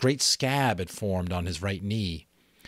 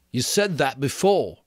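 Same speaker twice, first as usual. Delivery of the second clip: lightly voiced boy, a bit higher; Piggy's voice; 6 semitones higher, 6 dB louder than usual. Piggy answering Ralph, a lightly voiced boy.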